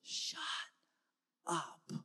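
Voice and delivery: hushed, breathless shut up; whispered command. Retold or imitated. Caleb silences the doubting people, hushed whisper. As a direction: hiss it low to silence them. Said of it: "And Caleb goes".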